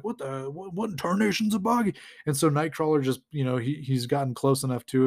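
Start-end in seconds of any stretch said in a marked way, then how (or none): 0.0-1.9 baffled cartoon cowboy, rising high and quick; sputter, then exclaim like a cowboy; cowboy T-Rex. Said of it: "the T-Rex is like".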